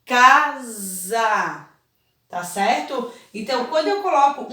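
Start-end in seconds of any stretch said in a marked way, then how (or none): 0.1-1.7 Casa with the S voiced as a buzzing Z, kaza; teacher showing S between vowels. Shown as a demonstration. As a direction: say Casa so the middle S sounds like Z. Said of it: "Ele tem o som de za".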